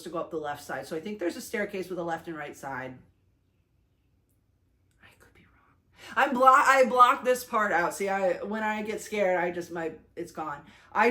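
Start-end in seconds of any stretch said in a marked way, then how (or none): 5.0-6.2 whisper. Real, not acted. Aside while recalling ride.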